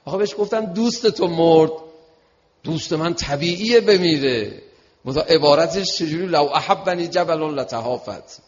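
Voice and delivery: loud and deliberate, hammering the words; impassioned preaching. Real, not acted. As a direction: proclaim it forcefully to the congregation. Preaches at full force.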